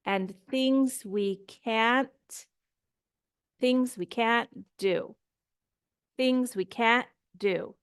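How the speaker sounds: "can't" stressed with a stop at the end; emphasized can't for contrast. Shown as a demonstration. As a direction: hit "can't" firmly and stop the t. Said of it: "the can't is gonna have a stop".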